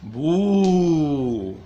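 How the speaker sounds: a high, loud playful ghost boo. Pretend. Teacher playing a ghost for the kids, loud playful boo. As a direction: spook the kids with a mock ghost sound. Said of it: "Como hacen los fantasmas".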